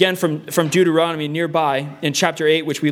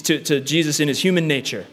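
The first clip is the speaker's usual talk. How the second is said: usual speaking voice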